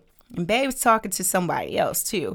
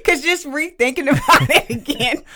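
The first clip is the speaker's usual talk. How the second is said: laughing through the words; real laughter; 5 semitones higher, 3 dB louder than usual. Real, not acted. Guest rethinking the night for her co-host, laughing. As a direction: talk while cracking up at the memory. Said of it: "erupting in laughter".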